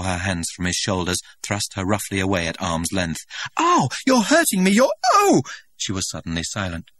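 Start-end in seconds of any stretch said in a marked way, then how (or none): 3.6-5.4 high, loud cry of pain and fright. Acted. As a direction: yelp out as the grip hurts. Woman hurt and frightened.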